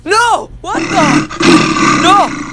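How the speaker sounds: loud high "No!" of alarm; panicked cry. Acted. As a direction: cry out as the army rises. Army summoned, hero panics.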